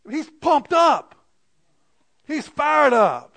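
loud, high, drawn-out emphasis; fired-up reply. Retold or imitated. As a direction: hype it up, stretching each word. Acts out his excited reply.

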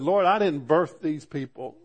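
a leader at the end of his rope; exasperated Moses. Retold or imitated. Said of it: "Moses finally had enough, said".

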